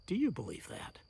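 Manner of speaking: ordinary delivery, nothing marked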